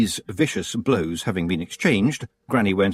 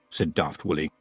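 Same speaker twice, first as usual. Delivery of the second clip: ordinary delivery, nothing marked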